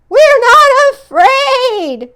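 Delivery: very high, loud, slow scream; shrieking denial. Acted. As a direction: scream it out in terror. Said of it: "screamed Frog and Toad".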